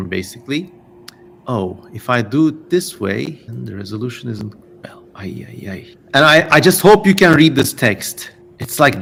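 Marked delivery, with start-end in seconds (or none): 3.5-4.5 breathy whisper to himself; muttering under his breath; whispered aside. Real, not acted. Video resolution fails mid-sentence. 4.8-5.9 dismayed murmur. Real, not acted. Dismay at bad video quality.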